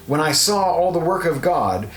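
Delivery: normal talking voice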